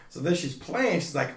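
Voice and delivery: normal talking voice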